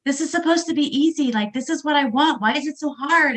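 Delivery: high, exasperated complaint; frustrated whine. Retold or imitated. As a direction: plead in frustration, voice up. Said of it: "I was so frustrated".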